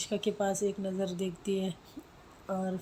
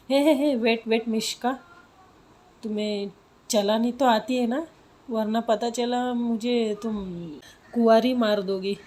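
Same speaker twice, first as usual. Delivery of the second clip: mock alarm; 2 semitones higher, 7 dB louder than usual. Friend panics about scooter riding.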